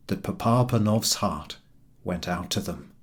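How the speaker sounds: plain reading voice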